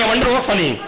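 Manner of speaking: giggling repeat of the line; can't hold back the laugh; laughing through it. Retold or imitated.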